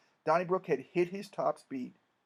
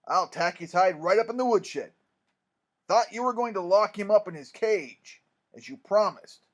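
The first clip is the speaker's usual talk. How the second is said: loud, raised angry threat; terrible-tempered minister; 4 semitones higher, 9 dB louder than usual. A narrator's reading. Irate minister threatening the boy's pet raccoon, loud and angry. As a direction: scold loudly with a hot temper. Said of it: "said the terrible-tempered minister".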